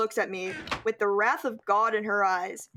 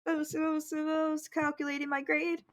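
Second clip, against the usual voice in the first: higher, timid little answer; mock fearful reply; 6 semitones higher, 4 dB quieter than usual. Student answering the wrathful teacher, mock fearful. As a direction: imitate a scared, stammering student. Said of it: "I replied with the fear of God in my soul".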